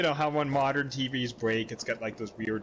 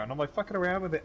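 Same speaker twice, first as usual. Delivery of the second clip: ordinary talk, nothing marked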